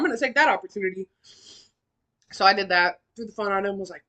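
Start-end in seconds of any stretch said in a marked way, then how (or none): none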